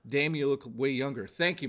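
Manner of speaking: ordinary delivery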